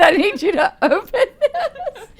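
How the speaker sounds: high, slow, laughing plea; wife laughing as she hands over gift; laughing giddy plea. Retold or imitated. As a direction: beg through laughter, way up high.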